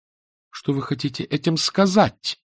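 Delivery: high, drawn-out alarmed question; horrified cry. A narrator's reading. Anna sees hatred in his face and cries out. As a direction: cry out slowly in rising horror. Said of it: "вскрикнула она, с ужасом вглядываясь".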